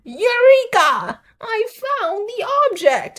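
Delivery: loud, high, drawn-out cartoon triumph; hammed-up squeal of discovery; mock triumphant discovery. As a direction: crow it slowly in a silly high voice. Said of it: "she's like, again".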